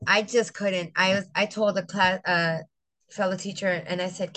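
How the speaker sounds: ordinary delivery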